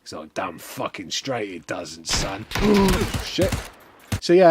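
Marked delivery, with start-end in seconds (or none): 0.3-2.5 lightly voiced wrestler, lower and much softer; low, quiet, slow cocky comeback; wrestler's reply. Voicing the wrestler in a story.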